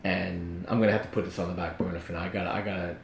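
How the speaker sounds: very low, soft, hesitant confession; halting and subdued, breaking bad news; imitating downcast friend. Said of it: "looks like he just lost his best friend".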